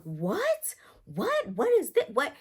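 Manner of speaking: high-pitched disbelieving outburst; imitated mom thrown by news; shocked. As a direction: shoot way up in pitch, repeating in disbelief. Voices mom's shocked reaction.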